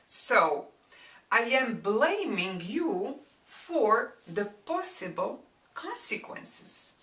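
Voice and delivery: normal talking voice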